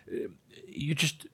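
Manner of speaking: usual speaking voice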